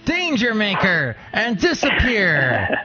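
radio announcer voice. Pretend. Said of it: "as I put on my radio announcer voice".

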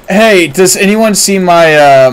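usual speaking voice

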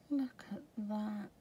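soft awed whisper; hushed look at that. Real, not acted. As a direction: whisper it like a secret treasure. Admiring paper up close.

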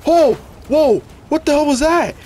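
high, loud startled cries; startled whoa. Real, not acted. Gamer startled by a weird effect on screen, loud whoas. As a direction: jolt back in alarm.

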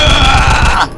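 sudden high, loud scream; loud high shriek; scream of fright. Real, not acted. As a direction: shriek at the scare. Jump scare.

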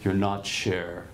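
slow, low and kindly; earnest, gentle warning. Retold or imitated. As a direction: say it gently, slowly, like a caring teacher. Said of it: "He's not mocking".

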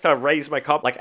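ordinary talk

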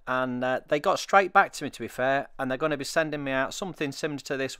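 normal talking voice

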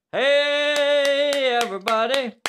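high, loud, drawn-out greeting; calling out. Pretend. Host hailing the audience, loud drawn-out call. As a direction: holler hello with a long first word.

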